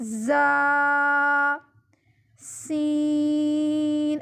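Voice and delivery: زا buzzed like a vibrating phone, سین hissed, both held; whistling sibilants. Shown as a demonstration. Teacher showing the whistle sound, long sibilant زا، سین. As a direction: draw out زا and سین with a whistle on the consonant. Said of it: "اس طرح کی آواز نکالنی ہے اس میں".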